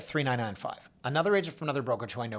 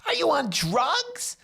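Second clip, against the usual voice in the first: much higher, slow, shrieking disbelief; shocked shriek; 4 dB louder, slower than usual. Retold or imitated. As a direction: squeal it like he can't believe the number. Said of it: "audible shriek".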